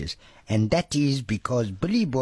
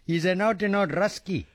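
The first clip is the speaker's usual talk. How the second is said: laughing; 7 semitones higher, slower than usual. Real, not acted. Laughing delight at Max.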